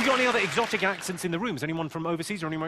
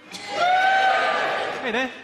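squeaky greeting; 5 dB louder than usual. Acted. Greeting West Country crowd.

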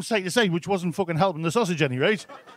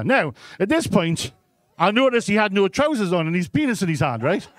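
ordinary talk, nothing marked

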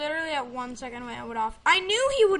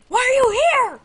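high, loud mock-indignant yell; mock outrage; 11 semitones higher, 11 dB louder, faster than usual. Host to his unplanned guest, mock-outraged yell. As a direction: screech it in hammed-up exasperation.

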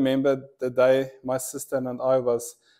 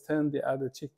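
soft divine voice; 8 dB quieter, faster than usual. Retold or imitated. God's words quoted.

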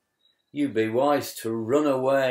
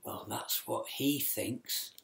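hushed sly whisper; 12 dB quieter than usual. Acted. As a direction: whisper it behind his back. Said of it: "The animals all whispered".

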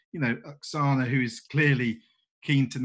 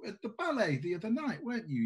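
higher, gushing host voice; Laskin's voice; 9 semitones higher, 3 dB quieter than usual. Acted. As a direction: fawn over the newcomer. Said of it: "Laskin says".

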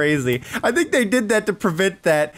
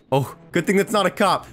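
normal talking voice

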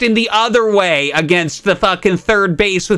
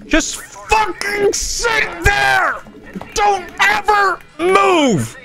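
slow, high furious scream; raging gamer; much slower than usual. Real, not acted. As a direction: drag out every word in rage. Streamer raging at enemy unit.

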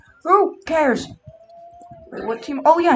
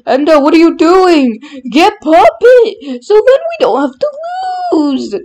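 shouting; 6 semitones higher, 7 dB louder than usual. Acted. Shouting orders at teammate.

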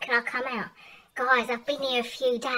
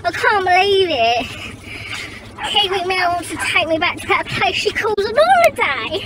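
higher, louder snooty Mad Mel complaining about the holiday; 10 semitones higher, 5 dB louder than usual. Acted. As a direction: play the stuck-up runaway sneering at the cheap holiday. Mad Mel character monologue.

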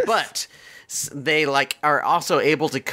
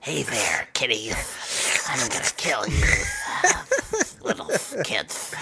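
softer, eerie monster lady voice with a taunting edge; 2 semitones lower, 5 dB quieter than usual. Retold or imitated. Retelling the movie's monster lady to her cohost, eerie taunt. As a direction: purr the threat like a creepy hag toying with kids. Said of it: "we see a little monster lady".

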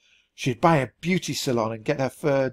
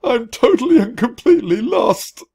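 much higher, loud sobbing wail; crying wail; 10 semitones higher, 8 dB louder than usual. A narrator's reading. Ranger wailing in tears to the mouse. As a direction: wail it while crying hard. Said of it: "He began to cry".

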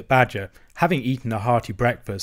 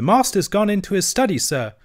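higher-pitched, earnest youngster; small creature's raised, respectful voice; young hedgehog; 8 semitones higher, 3 dB louder than usual. A narrator's reading. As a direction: report it like a deferential country lad. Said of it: "replied the hedgehog".

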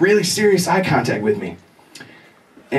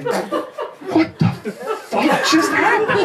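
very slow, higher, laughing bewilderment; stunned; 11 semitones higher, much slower than usual. Real, not acted. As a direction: drag it out in dazed disbelief. Stunned after hippie leaves.

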